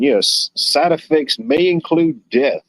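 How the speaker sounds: hammed-up side-effects announcer; mock drug ad disclaimer. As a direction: parody the fine print of a medicine commercial. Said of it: "the summary of that medication".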